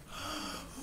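a high, soft, starstruck intake of breath; awed gasp. Retold or imitated. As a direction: gasp like a smitten teenage fan. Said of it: "every other guy in the audience was like".

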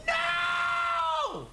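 very high, loud drawn-out cry; long anguished scream. Real, not acted. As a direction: howl it in defeat. Final defeat.

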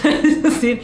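ordinary delivery

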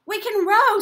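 put-on high, loud eager girl; girl offering to row, eager; eager Tina. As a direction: pipe up eagerly. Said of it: "said Tina".

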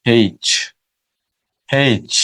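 Australian-style এইচ with an h sound at the start; Australian haitch. Shown as a demonstration. Teacher showing how Australians say এইচ. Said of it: "এই লেটারটা অস্ট্রেলিয়ানরা উচ্চারণ করে".

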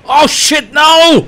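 sudden high yell of alarm; real panicked yell; panicked shout. Real, not acted. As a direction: cry out as the hit lands. Sudden danger in game.

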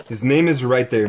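ordinary delivery

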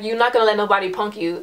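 usual speaking voice

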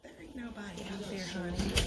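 low-voiced skeptical friend; Helen voice. Second character, Helen.

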